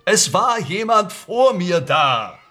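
insistent outburst. Acted. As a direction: push it out high and urgent, protesting. Suspect insists on his story.

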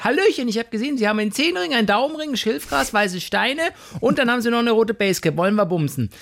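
high, sing-song mock politeness; chirpy doorbell visitor. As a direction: play an overly cheery stranger at the door. Acted doorstep swinger visitor.

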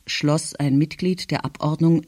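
plain reading voice

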